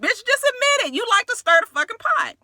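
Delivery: high-pitched teasing accusation; mock scolding. Viewer telling Kenya to admit stirring, mock scolding. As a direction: call her out in a high voice. Mock confrontation of absent castmate.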